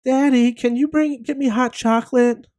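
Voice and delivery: put-on high, little-girl voice asking Daddy; daughter's voice. Father imitates daughter calling for hot chocolate in a high voice. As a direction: pitch it up like a small child wheedling. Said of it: "Well, I get a call".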